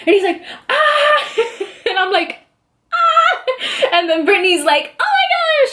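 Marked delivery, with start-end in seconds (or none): 0.7-1.3 high-pitched squeal imitating an overjoyed dad; held, contained scream of celebration; mock joyful scream. Imitating dad jumping with joy. 2.9-3.4 very high re-enacted squeal; mock joyful scream. Her answering scream to dad. 5.0-5.7 excited squeal. Retold or imitated. Imitating Brittany's thrilled reaction.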